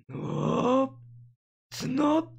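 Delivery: high-pitched, phrase spoken in reverse; backwards speech. Shown as a demonstration. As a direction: flip the words, say them backwards. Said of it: "you would say backwards".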